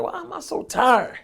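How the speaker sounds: high, slow, puzzled question; imitating himself nodding off, confused; mock sleepy wonder; retold drowsy puzzlement. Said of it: "And I was like".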